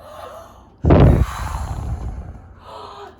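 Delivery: long loud huffing blow; loud wolf blowing the house down; huge blow. Pretend. Reader blows the house down.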